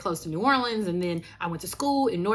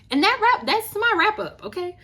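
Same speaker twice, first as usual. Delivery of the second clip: excited wrap-up; 12 semitones higher, 5 dB louder than usual. Real, not acted. Excited sign-off.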